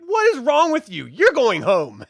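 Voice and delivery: high, loud parental telling-off; an exasperated, loud reprimand; scolding yell. Retold or imitated. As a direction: scold the kid like a furious parent. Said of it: "You're like".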